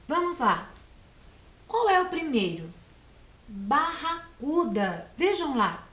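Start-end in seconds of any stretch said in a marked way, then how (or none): 3.5-5.1 slow, careful naming of BARRACUDA; enunciação lenta. Shown as a demonstration. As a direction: stretch Barracuda out slowly, in connected chunks. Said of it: "possuem quatro sílabas". (Barracuda dito devagar, com cuidado)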